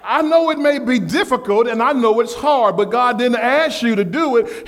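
usual speaking voice

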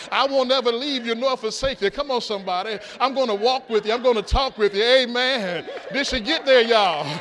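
rally the congregation at full force; excited preaching. Acted. Pastor cheering on blindfolded wife, excited preaching.

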